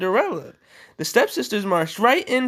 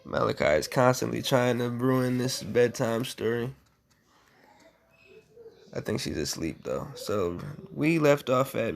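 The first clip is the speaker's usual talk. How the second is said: lower, softer bedtime murmur; hushed aside; 8 semitones lower, 6 dB quieter than usual. Real, not acted. Parent checking if the child is asleep, hushed and low.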